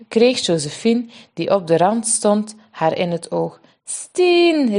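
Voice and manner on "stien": put-on high, cheerful child's call; a little girl waving to her sister; bright high shout of a toddler. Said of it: "riep de kleuter".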